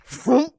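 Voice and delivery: high, loud whoosh noise; swooping vroom. Acted. Game master mimicking a character being snatched up, high loud vroom. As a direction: make a sudden swooping vroom. Imitates the sudden pickup.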